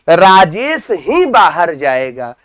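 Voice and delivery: slow, "ही" swung up in pitch; stress on ही. Shown as a demonstration. Teacher showing how a particle adds force, stress on "ही". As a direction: make "ही" the peak of the sentence. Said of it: "किसी शब्द पर विशेष जोर दिया जाए".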